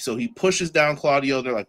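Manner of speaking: ordinary talk, nothing marked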